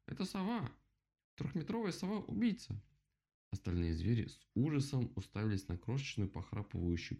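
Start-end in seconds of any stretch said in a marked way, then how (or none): 0.1-2.8 high-pitched alarm cry, modest volume; yelling. A narrator's reading. Rabbit warns of a killer owl, yelling. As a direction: sound the alarm high and urgent. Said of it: "заорал кролик".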